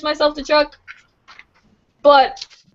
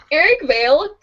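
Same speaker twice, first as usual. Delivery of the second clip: normal talking voice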